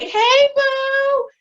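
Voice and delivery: a sudden high, flirty hey boo; flirty high coo. Retold or imitated. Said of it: "I'm out there like".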